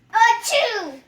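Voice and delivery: big high acted sneeze; loud sneeze. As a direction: blast out a cartoon sneeze. Character sneezes.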